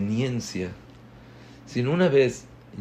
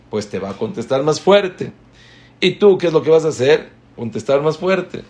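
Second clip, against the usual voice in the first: mock escalation; 5 semitones higher, 6 dB louder than usual. Rabbi describes escalating answers.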